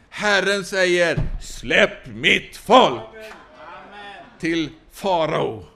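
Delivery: slow, weighty prophet's command; a prophet announcing God's word to the king, slow; Moses proclaiming. Acted. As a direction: drag out let my people go like a decree.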